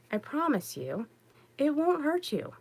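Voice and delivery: ordinary talk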